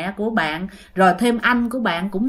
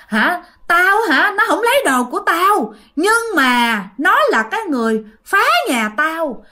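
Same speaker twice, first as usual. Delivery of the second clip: higher-pitched, affronted retort; heated imitated rebuttal, pitched up; indignant spirit; 6 semitones higher than usual. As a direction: act out a ghost arguing back. Spirit angrily rebuts the priest.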